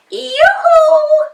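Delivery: high, loud yoo-hoo call; calling into the dark sky. A narrator's reading. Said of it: "she called".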